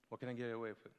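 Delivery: usual speaking voice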